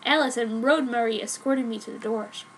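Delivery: ordinary delivery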